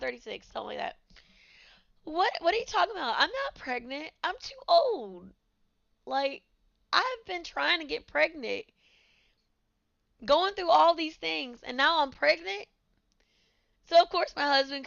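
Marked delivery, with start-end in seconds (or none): 2.0-12.7 higher, mock-shocked protest; retold disbelief. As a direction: replay the shock of the news, pitched up. Retelling shock at pregnancy news.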